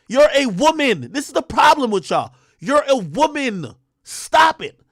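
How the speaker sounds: angry streamer yelling at a rapper's claims; heated rant. Real, not acted. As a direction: shout her down, fired up.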